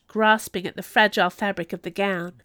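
ordinary delivery, nothing marked

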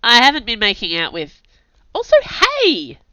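flustered, cut-off denial; protest, then a squeaky hey; indignant protest. Acted. Narrator protests May's teasing.

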